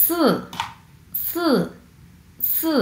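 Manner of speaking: "সু" short and falling, fourth tone. Shown as a demonstration. Teacher demonstrating Chinese four, fourth tone. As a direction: say "সু" with a sharp drop. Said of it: "চার number tone আমরা কিভাবে উচ্চারণ করব".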